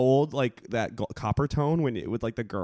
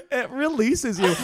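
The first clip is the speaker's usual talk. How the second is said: laughing; 13 semitones higher, slower than usual. Real, not acted. Laughs repeating friend.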